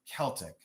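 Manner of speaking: "Celtic" as KEL-tik, softly; quiet "Celtic" with a hard K; hard-K pronunciation. Shown as a demonstration. Seller trying the word, "Celtic" softly with a K. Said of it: "I say Celtic".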